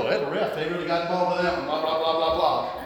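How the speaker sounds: soft, droning mock gripe; imitating his own grumbling; grumbling spiel. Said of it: "I had my little spiel".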